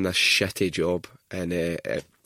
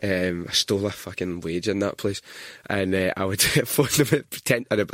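usual speaking voice

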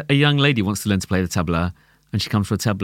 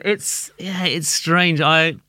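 ordinary delivery

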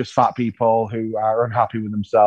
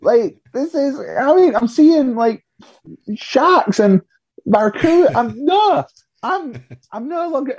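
high, excited squeaky voice climbing higher; giddy disbelief; 13 semitones higher, slower than usual. Retold or imitated. Guest tells producers he is too happy, high and giddy. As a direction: gush like a kid in paradise. Excited gushing about paradise.

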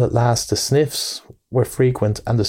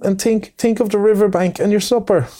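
high, stammering little mole, sobbing out his lost home; a heartbroken small creature stuttering through sobs; tearful Mole; 9 semitones higher than usual. A narrator's reading. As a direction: pour it out in broken, pitched-up grief. Mole pleads through tears.

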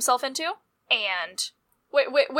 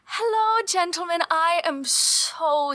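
high, sweet host voice on hello; polite host greeting; 6 semitones higher, slower than usual. Acted. Hostess greeting the annoyed Grey Cloaks, sugary. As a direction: lay on the charm for the guests.

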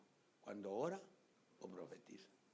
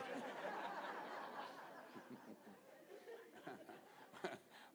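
high breathy laugh; long airy chuckle; 12 semitones higher, 6 dB quieter than usual. Real, not acted. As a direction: laugh at the joke. Preacher laughs at own joke.

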